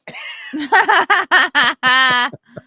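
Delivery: loud, high burst of real laughter; big laugh. Real, not acted. Cracks up at own joke.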